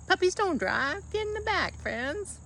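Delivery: telling the puppies to get in the back, high and playful; talking to dogs. Retold or imitated.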